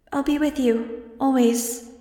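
put-on high, slow child's voice; little girl voice. A narrator's reading. Angel reassuring Max before she's gone, high and slow. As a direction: sound like a small comforting girl. Angel's remembered last words.

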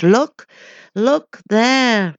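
loud, high impatient shout; roaring impatient. A narrator's reading. The roaring crowd urges the hesitant man, impatient shout. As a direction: bark it at him, impatient. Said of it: "came roaring voices, impatient".

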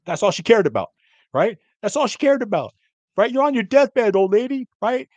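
really going off, voice pushed up. Real, not acted. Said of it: "I had to go off".

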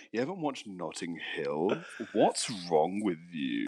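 low, soft, slow posh flirt; mock a smooth fuckboy cooing down the phone. Friend repeats Jack's line to his missus, mocking flirting voice. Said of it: "which is, like, a flirting voice".

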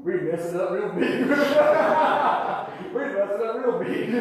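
chuckling through the words; breaking into laughter mid-line; laughing. Real, not acted. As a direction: laugh as you admit the blunder. Retelling a blunder, laughing.

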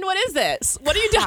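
rising high disbelief; incredulous. Retold or imitated. Reacting to partner's dirty talk.

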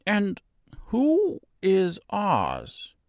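high, very slow question; naive, high-voiced straw man; puzzled scarecrow. A narrator's reading. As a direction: draw it out in innocent puzzlement.

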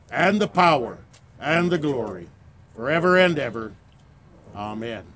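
steady, slightly subdued recited prayer. Acted. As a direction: lead the Lord's Prayer reverently. Leading the Lord's Prayer.

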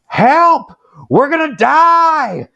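high-pitched mock scream, drawn out; imitated screaming. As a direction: wail it in a hammed-up panic. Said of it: "she starts screaming".

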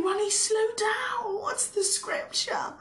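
high-pitched mock listener interrupting; high voice mimicking an audience objection; imagined listener. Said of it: "You're probably saying".

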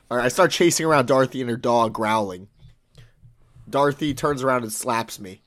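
usual speaking voice

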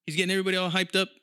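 ordinary talk

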